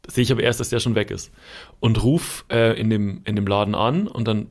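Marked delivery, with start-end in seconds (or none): none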